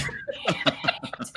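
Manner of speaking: breathy whispered curse. Retold or imitated. Dreading the stranger's chat, whispering a curse. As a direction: hiss it under your breath with a laugh. Dreading talk, muttering aside.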